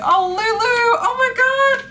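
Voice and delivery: moved by Lulu's note; touched squeal. Real, not acted.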